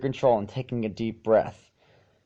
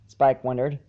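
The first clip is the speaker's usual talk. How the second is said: ordinary delivery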